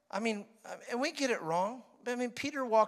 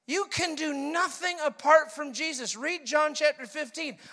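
ordinary talk, nothing marked

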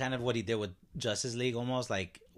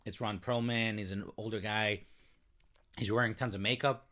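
ordinary delivery

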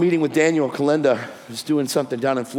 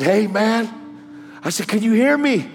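calling out; 7 semitones higher than usual. Retold or imitated. Calling to injured crash victim.

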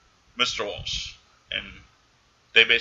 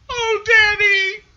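very high, loud, stretched wail; mock crying child; 8 dB louder, much slower than usual. Voicing the sobbing daughter crying to her father, high wail. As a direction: wail it like a sobbing little girl.

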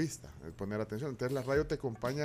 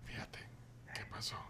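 whispering; 7 semitones lower, 18 dB quieter than usual. Pretend. Host whispering along with whisper messages.

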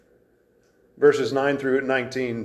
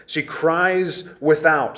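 ordinary delivery

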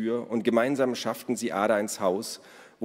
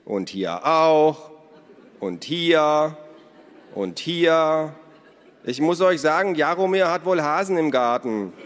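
raised, loud call from a distance; 8 semitones higher, 8 dB louder, slower than usual. A narrator's reading. Naru reports holes from the garden, calling out. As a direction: keep calling it in to those indoors. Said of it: "hörten sie Naru von draußen rufen".

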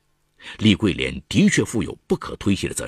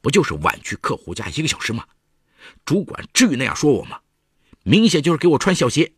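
rising indignant complaint; 6 semitones higher, 4 dB louder than usual. A narrator's reading. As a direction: grumble it, building to outrage. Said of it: "愤愤不平地说".

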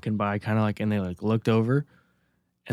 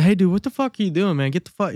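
high, raised challenge; indignant confrontation; 8 semitones higher, 4 dB louder than usual. Retold or imitated. Resident confronting a guy in the recycling bins, indignant challenge. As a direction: confront him, pitched up and pushy. Retold confrontation with bin picker.

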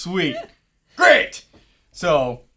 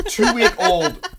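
laughing; 11 dB louder, much slower than usual. Real, not acted. Laughing at his own story.

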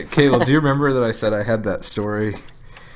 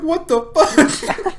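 much higher, slow laughing disbelief; mock shocked brother, laughing; 6 dB louder, much slower than usual. Retelling his brother's reaction, high slow laughing disbelief. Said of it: "My brother's like".

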